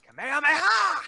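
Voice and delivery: high, loud attack shout; anime battle cry. Pretend. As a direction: yell it like a cartoon hero.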